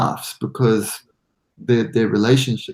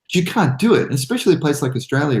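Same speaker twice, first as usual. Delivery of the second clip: normal talking voice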